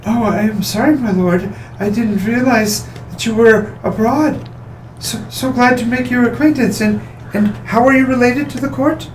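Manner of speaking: high, prim, demure lady voice; demure noblewoman. Acted. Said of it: "pulls up to act more demure and goes".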